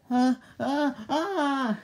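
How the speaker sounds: high, loud shrieks; frightened screams. Acted. Woman scared to death by the creature, frightened screams.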